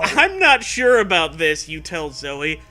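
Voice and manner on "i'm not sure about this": high, loud worried voice; nervous nerd. Acted. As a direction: say it scared.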